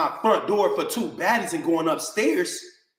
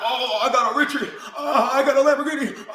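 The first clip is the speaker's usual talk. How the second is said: mock flexing; 5 semitones higher than usual. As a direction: imitate a braggart showing off his cars. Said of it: "I'm not flexing when I get it".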